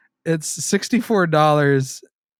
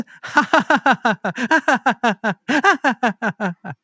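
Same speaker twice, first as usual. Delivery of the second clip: high giggle; 9 semitones higher, 4 dB louder than usual. Acted. Laughing in anime voice.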